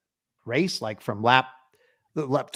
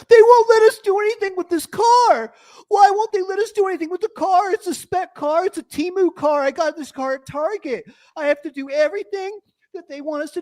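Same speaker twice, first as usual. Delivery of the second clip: very high, whiny complainer imitation; exaggerated high-pitched griping; mock complaining teams; 5 dB louder than usual. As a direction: mimic teams moaning in a squeaky wail. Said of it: "All you do is complain".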